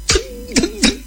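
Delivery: high, percussive stomping noise; storyteller's footstep sound effect; imitated footsteps. A narrator's reading. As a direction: voice heavy footfalls as sharp beats.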